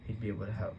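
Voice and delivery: plain reading voice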